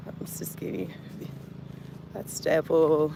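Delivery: whispered, almost silent; whispered aside. Real, not acted. Vlogger to chat after saying she doesn't care, whispered take-back. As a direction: breathe it as a quick secret.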